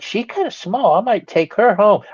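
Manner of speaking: high cooing over a small raccoon; mock cute voice. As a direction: coo over the little animal. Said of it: "I was like".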